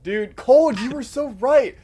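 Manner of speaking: excited shout. Real, not acted.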